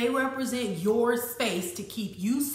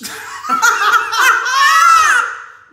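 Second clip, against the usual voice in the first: loud cackling laughter; 13 semitones higher, 14 dB louder than usual. Real, not acted. Outraged laughter.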